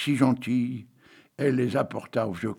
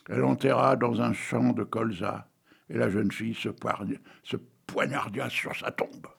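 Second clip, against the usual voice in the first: ordinary talk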